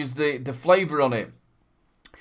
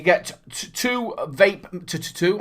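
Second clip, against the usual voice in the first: tripping and stuttering on "two"; real stumble, repeating the t; slower than usual. Real, not acted. Reviewer listing what you get, stuttering on the number. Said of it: "I'm stuttering".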